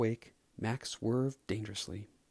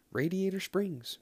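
proud; 8 semitones higher than usual. Acted. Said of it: "Mater replied proudly".

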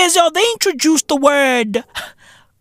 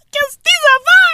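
squeaky high mock snake, much higher and louder; 14 semitones higher, 4 dB louder, faster than usual. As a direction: hoot it up high like a silly snake. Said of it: "Snake say".

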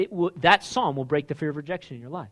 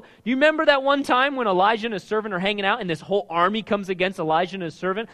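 usual speaking voice

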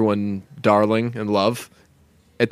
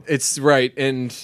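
ordinary talk

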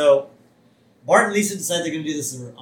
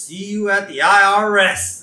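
auditor's line, higher, louder and drawn out; slow, raised mock-official send-off; lightly voiced auditor; 6 semitones higher, 8 dB louder than usual. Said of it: "the auditor says".

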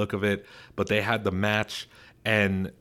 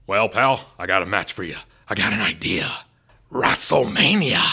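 Vince McMahon impression; 6 dB louder than usual. Boss on the phone to Stone Cold, gruff pitch voice. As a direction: pitch the match like a hammed-up old promoter. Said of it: "Vince McMahon just saying".

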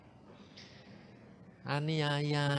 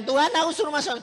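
pleading high prayer to God; praying aloud; 8 dB louder, much faster than usual. Retold or imitated. Preacher retelling his prayer, calling up to God pleading.

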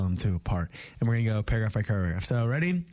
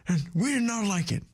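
grandpa voice; 12 semitones higher than usual. Acted. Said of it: "I'll do Grandpa".

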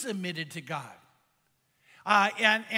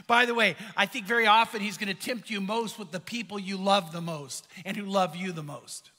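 normal talking voice

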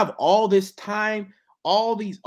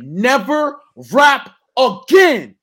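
loud, slow, higher dismissal; harsh verdict; 5 semitones higher, 11 dB louder, much slower than usual. Retold or imitated. Rapper telling a self-doubting rapper off, loud and slow. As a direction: slam the door on him slowly.

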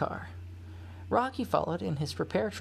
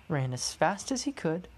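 ordinary delivery, nothing marked

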